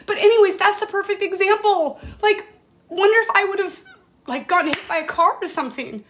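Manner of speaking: high, strained by welling emotion; emotional. Real, not acted. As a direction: push on through real upset, pitched high. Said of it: "that made me so emotional".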